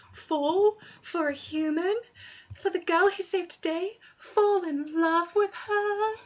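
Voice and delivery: high, slow, sweetly incredulous Esme voice; imitating Esme. Friend quotes Esme's shocked reaction, high and slow. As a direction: stretch each word out, high and wondering. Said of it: "just her going".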